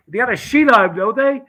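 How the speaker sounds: high, loud, insistent question; retold agitated outburst; acted alarm. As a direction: push it up high and loud, as if panicked.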